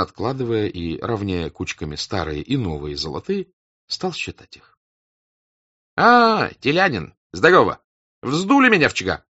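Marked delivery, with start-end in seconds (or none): 6.0-9.2 high, loud hearty hail; loud greeting. A narrator's reading. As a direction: greet him loudly. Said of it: "послышался голос Денисова".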